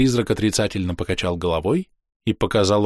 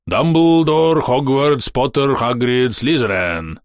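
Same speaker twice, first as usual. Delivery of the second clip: mock dark incantation; slower than usual. Gamer recites wizard names, mock dark incantation. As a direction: intone the names as if summoning a monster. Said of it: "Это ты сейчас Ктулху призвал".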